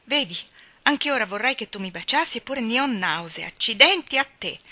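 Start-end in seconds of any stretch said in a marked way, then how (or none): 3.5-4.6 loud, high burst of exasperation; exasperated shout. Acted. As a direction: hurl the curse out in dread. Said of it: "Gridai, esasperata dal presentimento".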